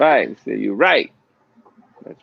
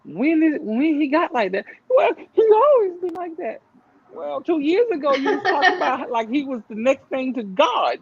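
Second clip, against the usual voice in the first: incredulous retort; 9 semitones higher than usual. Retold or imitated. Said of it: "And you're like".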